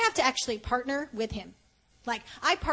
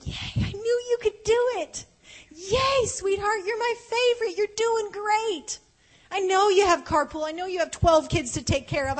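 much higher, bright cheering voice; 7 semitones higher, 4 dB louder than usual. Retold or imitated. Heaven cheering a tired homeschool mom, high excited praise. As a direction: cheer someone on like their biggest fan.

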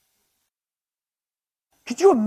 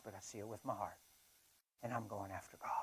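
breathy whispered vow, barely voiced; 9 semitones lower, 26 dB quieter than usual. Real, not acted. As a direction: whisper it like a secret promise. Intimate confession mid-sermon.

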